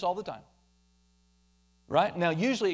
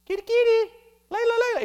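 much higher sing-song kitty call; calling the cat; faster than usual. Pretend. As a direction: coax the cat to come. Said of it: "We go".

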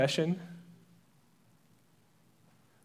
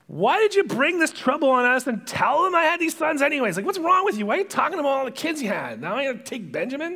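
high, loud scolding, acted out; arguing, not happy; 9 semitones higher, 5 dB louder than usual. Jacob to his sons over Benjamin, arguing unhappily. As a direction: rant at them with voice pitched way up. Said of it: "Jacob's kinda arguing with him. He's not very happy".

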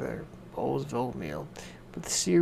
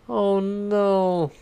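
a mild higher, louder wail; girl wailing after falling; 5 semitones higher, 6 dB louder than usual. A narrator's reading. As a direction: cry out as the chair breaks. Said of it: "Goldilocks wailed".

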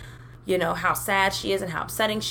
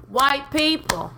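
drawn-out sassy call-out; 7 semitones higher, much slower than usual. Shown as a demonstration.

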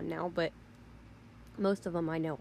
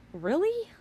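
incredulous; 11 semitones higher, 4 dB louder than usual. Real, not acted. Disbelief at the Snapchatting bystander.

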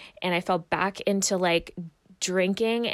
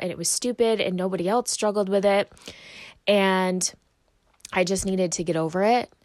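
ordinary delivery

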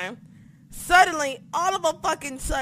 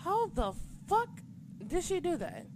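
softer, higher, half-muttered disbelief; a hushed, stunned replay of the shock; quiet stunned wonder; baffled disbelief; 3 semitones higher, 4 dB quieter than usual. Retold or imitated. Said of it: "I was like".

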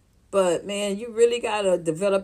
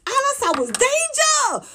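sharply raised disbelieving yell; shocked disbelief; 12 semitones higher than usual. Retold or imitated. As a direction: squeal in disbelief at her. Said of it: "I was like".

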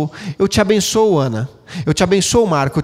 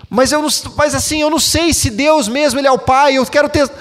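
higher, pleading voice of an unsure believer; doubting churchgoer; 8 semitones higher, 3 dB louder than usual. Retold or imitated. Pastor voices a churchgoer doubting to him, pleading. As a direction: whine the doubts up high, half-mocking.

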